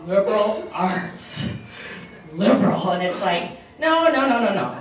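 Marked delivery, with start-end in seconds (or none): 0.1-2.9 sneering mock disdain. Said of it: "people look at you and say". 3.8-4.8 emphatic denial. Retold or imitated. Rebutting critics of liberal arts.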